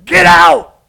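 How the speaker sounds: high, loud "Get out!" at a demon; loud imitated rebuke; shouted command. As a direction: yell it like an exorcist.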